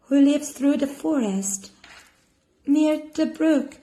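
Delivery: put-on high, innocent little girl. Girl telling the wolf where grandma lives. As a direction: naive and chatty, a bit flustered.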